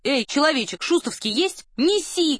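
high, lively hail to a waiter; calling out. A narrator's reading. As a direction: order it as a boisterous call. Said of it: "крикнул гусар". (задорный окрик, высоким голосом)

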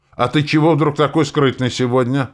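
high, loud snappy comeback; snappish retort. A narrator's reading. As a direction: throw the question back irritably. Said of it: "огрызнулся Кер". (огрызаясь)

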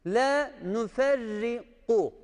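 slow Quran recitation of La nuferriku, qaf from the throat; correct recitation. Shown as a demonstration. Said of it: "Buraya gu deniyor". (nuferriku'daki kafı gırtlaktan, yavaşça oku)

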